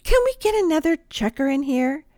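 retelling a lady calling for a checker. Retold or imitated. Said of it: "called out".

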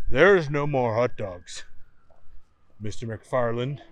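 firm refusal. Acted. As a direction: close the matter.

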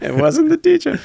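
amused high-pitched echo; squeaky high repeat. Retold or imitated. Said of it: "That's what I think".

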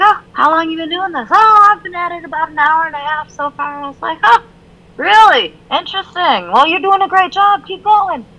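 high, knowingly sweet praise; mock sweet. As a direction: play along, hiding suspicion. Mom playing along, mock sweet.

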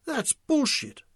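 very high, slow strident protest. A narrator's reading. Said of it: "the man replied stridently".

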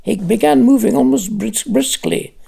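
ordinary delivery

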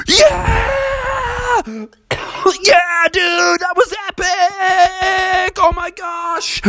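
cheering crowd. Acted. Fans cheer Bubby's burning solo, high shouts. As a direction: cheer like a wild fan.

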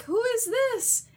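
high, slow, puzzled question; baffled protagonist. Retold or imitated. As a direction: act out baffled surprise in a high voice.

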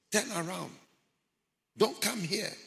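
acted cry of warning; urgent warning. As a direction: call out a warning to keep away. Voices in hell warning him off.

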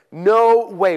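strong emphatic denial. Shown as a demonstration. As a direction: hit No way hard and loud. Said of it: "that is a pretty strong emphatic".

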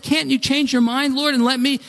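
high-pitched begging; pleading. Acted. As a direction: bargain desperately with God. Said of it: "tells us he pleaded".

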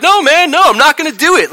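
high, loud, heated protest; yelling refusal. Retold or imitated. John refusing to baptize Jesus, yelling. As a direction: shout the refusal back at him. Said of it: "They were yelling at each other".

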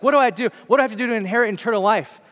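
high, loud, rushed plea; urgent pleading. Acted. Man running up and falling on his knees, urgent pleading. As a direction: blurt the question out, pitched high and fast.

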